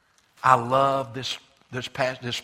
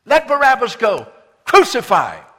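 loud mob shout, much higher; crowd crying out; 9 semitones higher, 13 dB louder than usual. Retold or imitated. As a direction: bellow it like an angry crowd. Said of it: "with one voice cried".

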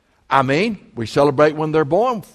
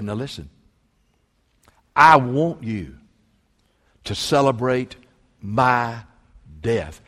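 lowered, slow, gentle voice of Jesus; a hint of Jesus speaking, slowed and softened; voicing Jesus; 6 semitones lower, 5 dB quieter than usual. Preacher voices Jesus to the church, slow and soft. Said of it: "But Jesus said".

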